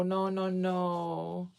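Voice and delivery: soft, slow mock dismissal; slow drawn-out no. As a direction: stretch each no out lazily. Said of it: "people just be thinking".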